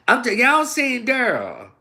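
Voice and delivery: loud, high, brash woman barging in; hammed-up loud rude woman; rude loud demand. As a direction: bark the question at the dice players. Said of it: "a bitch make people look at you like".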